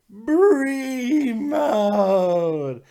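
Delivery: loud, high, long-stretched catchphrase shout; mock hype yell. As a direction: bellow it like an over-the-top fan cheer. Mock hype call for wrestler.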